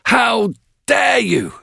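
slow, furious shout; thundering shout. A narrator's reading. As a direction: roar it at the guest. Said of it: "he thundered".

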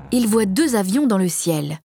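plain reading voice

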